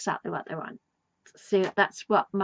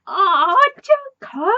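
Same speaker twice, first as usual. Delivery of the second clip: much higher, louder, half-crying squeal; fond squeal; 10 semitones higher, 8 dB louder than usual. Retold or imitated. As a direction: melt at Jericho with a squeal. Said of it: "It's like".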